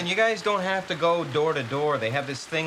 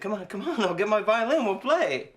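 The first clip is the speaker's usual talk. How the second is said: higher, louder, pleading with a laugh; urgent coaxing; 8 semitones higher, 3 dB louder than usual. Acted. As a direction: beg her to play. Urgent coaxing of his mother.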